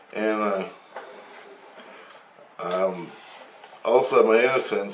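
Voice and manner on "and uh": ordinary talk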